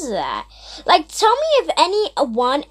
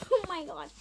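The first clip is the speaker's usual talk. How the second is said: shrieking laugh, much higher; real burst of laughter; 4 dB louder, faster than usual. Real, not acted. Caught off guard on camera, shrieking laugh. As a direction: laugh out loud in shock. Sudden mishap while filming.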